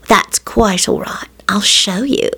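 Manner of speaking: slow seductive whisper; sultry whisper. A narrator's reading.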